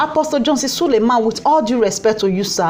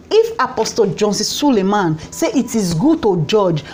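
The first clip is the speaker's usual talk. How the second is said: normal talking voice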